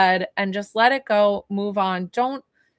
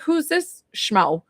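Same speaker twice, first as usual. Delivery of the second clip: slow, higher, drawn-out doubtful "Who's this schmo?"; mock skeptic; 6 semitones higher, much slower than usual. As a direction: stretch it out like a suspicious client. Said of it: "do you like how I call myself a schmo?".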